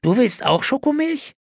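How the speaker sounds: put-on high child voice, surprised; astonished boy. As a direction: gasp it in wide-eyed wonder. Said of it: "staunte Stanley".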